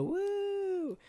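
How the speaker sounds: squeaky celebratory woo; high falsetto woo. Real, not acted. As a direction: cheer it up high like a party whoop. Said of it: "celebratory cheer".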